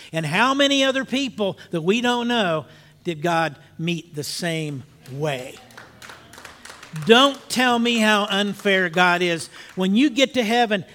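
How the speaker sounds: fervent pulpit preaching; preaching at full force. Acted. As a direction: drive the message home to the congregation.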